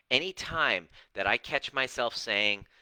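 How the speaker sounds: normal talking voice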